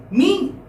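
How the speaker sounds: high, long Me with a stretched E; student protesting Me? when blamed for absence; emphatic me. Shown as a demonstration. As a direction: hit Me with indignant emphasis. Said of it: "when you are saying it with emphasis".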